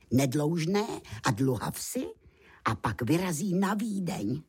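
ordinary delivery, nothing marked

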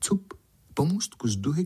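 ordinary delivery, nothing marked

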